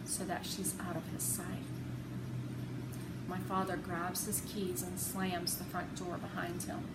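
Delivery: ordinary delivery, nothing marked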